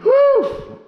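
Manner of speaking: high, loud puff of breath; relieved whew. Real, not acted. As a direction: blow out a big relieved whew. Climbing into the house, exhaling.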